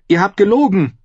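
high, loud accusation; accusing. Acted. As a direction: throw the lie back at him. Sansa accuses Dontos. (hoch und laut anklagend)

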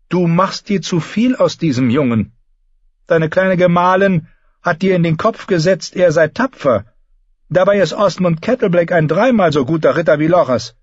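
high-pitched, impatient queen; scolding queen mother. A narrator's reading. Queen belittles her son's favourite knight, high and disapproving.

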